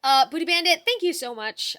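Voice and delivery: excited thanks. Real, not acted. Greeting chat donor loudly.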